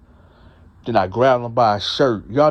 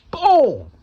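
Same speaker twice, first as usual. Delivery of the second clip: imitated smack; 13 semitones higher than usual. Smacking cellmate's face.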